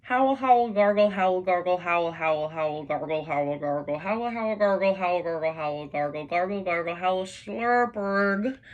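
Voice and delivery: loud howling and gargling noises, rising into a slurp; garbled PA noise. Acted. The Vogon ship's PA crackling to life, loud garbled howls. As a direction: howl and gargle like a broken loudspeaker.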